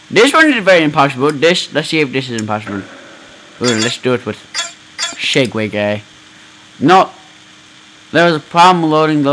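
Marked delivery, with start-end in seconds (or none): none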